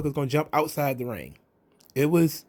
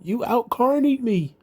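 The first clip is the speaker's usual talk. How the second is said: higher, slower half-hearted protest; lightly mocking Matt Hardy's flat surprise; mock unbothered Hardy; 9 semitones higher than usual. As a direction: parody a hero who feels nothing, pitched up. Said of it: "There was no shock".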